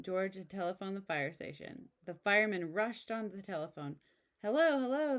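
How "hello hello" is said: loud, high hello into the phone; urgent phone answer. Acted. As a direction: call down the line with urgency.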